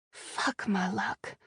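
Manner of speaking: slow, soft whisper to herself; whispered aside. Acted. Captive whispers to herself about her luck, softly. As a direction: breathe it out in defeat.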